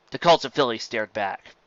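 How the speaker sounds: plain reading voice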